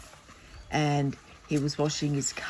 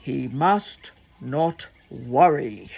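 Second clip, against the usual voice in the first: stern doctor bear; 3 semitones lower, 3 dB louder than usual. Acted. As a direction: space out each word like a grave doctor. Doctor bear's orders repeated from picture.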